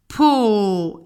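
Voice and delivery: PO held long and loud; "Po" sounded out for spelling; drawn-out syllable. Shown as a demonstration. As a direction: stretch out the vowel of "Po", loudly. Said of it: "je découpe toujours mon mot en son".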